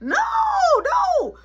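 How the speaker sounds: high, drawn-out cries of refusal; mock pleading. Kid begging mama not to finish, high mock wail. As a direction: wail it high in comic dread.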